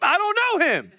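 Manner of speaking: high-pitched, hammed-up outburst; heated denial. Peter cursing and denying Jesus, high mock-angry outburst. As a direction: protest it in mock outrage.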